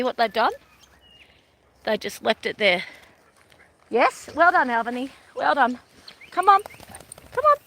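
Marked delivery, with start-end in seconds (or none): 3.9-7.6 high, bright praise for the dog. Real, not acted. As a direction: cheer the dog on in a higher voice.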